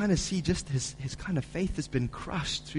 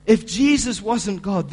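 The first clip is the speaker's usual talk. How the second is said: "If Jesus wasn't God" louder and higher, slow; emphatic preaching; 6 semitones higher, 9 dB louder, slower than usual. Acted. Preacher repeating to the church, louder and slow. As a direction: hammer the "if" line home.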